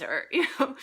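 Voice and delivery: usual speaking voice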